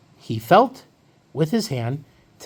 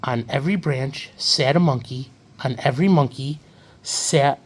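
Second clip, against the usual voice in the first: usual speaking voice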